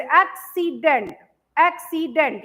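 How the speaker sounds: "Accident" in three beats, एक - सी - dent; split into syllables. Shown as a demonstration. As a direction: separate each syllable of "accident". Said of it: "ये three syllables में आ गया". ("Accident" को तीन हिस्सों में तोड़कर)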